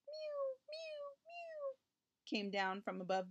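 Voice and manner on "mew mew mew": very high, soft kitten mews; kitten mewing. A narrator's reading. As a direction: imitate a tiny cat crying out in a thin high voice. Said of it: "mewing as if it would never stop".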